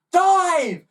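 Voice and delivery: high loud command; boy's urgent high shout; ordered. Acted. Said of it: "ordered the boy".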